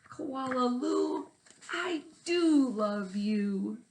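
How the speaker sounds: loving mother. Acted. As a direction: stretch it out with love. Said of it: "fling her arms around her neck and say".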